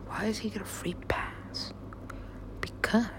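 conspiratorial whisper. Real, not acted. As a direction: keep it a secret whisper. Whispered grumble continues.

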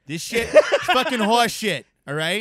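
slow, shouted Boston accent. Shown as a demonstration. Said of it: "Your whole lungs are in it".

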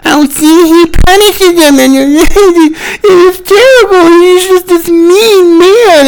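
high, whimpering mockery of a whiner, slow at the end; whiny mock voice. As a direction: play the crybaby, high and put-on. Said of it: "the left is whining".

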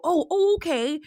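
high, startled mock politeness; nice people humoring. Acquaintances humoring her mask, mock startled. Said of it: "and they go".

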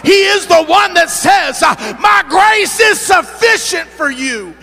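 high, loud climactic preaching; preaching at full force. Acted. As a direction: cry it out at the peak of the sermon. Climactic preaching, higher and louder.

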